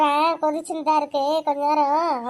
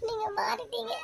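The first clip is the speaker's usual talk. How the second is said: high, choked and very soft; barely getting "மாறிட்டீங்க" out through tears; tearful; 6 semitones higher, 7 dB quieter than usual. Acted. Wife hurt by her husband, softly tearful. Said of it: "இப்போ எதுக்குடி அழுதுட்டு இருக்க?".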